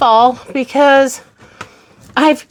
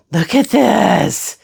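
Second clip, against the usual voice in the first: drawn out, savoring the reveal; slow, impressed showing off; slow proud look; 3 semitones lower, slower than usual. Real, not acted. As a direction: linger on it, admiring. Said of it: "admiring the new shoe shelf".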